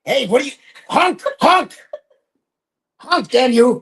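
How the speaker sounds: high, loud furious honks; angry honking. Pretend. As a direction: yell and honk at the goose. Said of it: "He just starts honking back".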